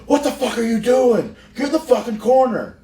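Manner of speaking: high, ranting mock shout at a partner; imitated furious yell. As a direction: scream the order like an angry veteran. Retelling X-Pac screaming in ring.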